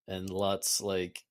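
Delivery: ordinary talk, nothing marked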